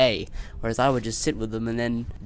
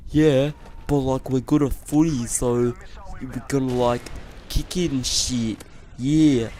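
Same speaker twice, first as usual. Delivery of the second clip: lightly voiced careless lads; a hint of bored, smug footy guys; mock dumb boys. Boys answering her scolding, lightly mocked. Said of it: "And they're like".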